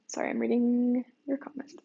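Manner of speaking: audible swallow between words; swallowing mid sentence. Real, not acted. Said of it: "Sorry that you can hear me swallow".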